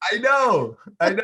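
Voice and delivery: high, loud exclamation; emphatic agreement. Real, not acted. Host agreeing with the co-host, emphatic. Eager agreement about the donut scene.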